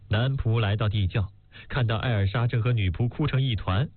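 ordinary delivery, nothing marked

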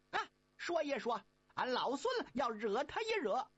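high, quick monkey voice; high-pitched eager Monkey King. Acted. Monkey King asking brothers about master, high and quick.

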